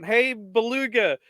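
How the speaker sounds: high, drawn-out mock call; imitated kids' yelling. Retelling kids at camp hailing a player, mock yelling. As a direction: call out like heckling kids, without full volume. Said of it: "these kids just yelling at him".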